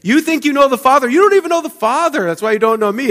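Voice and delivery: high, fast mocking retort; incredulous challenge. Preacher voicing Jesus rebuking the crowd, high and fast. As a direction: throw it back at them, high and sharp. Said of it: "Jesus says".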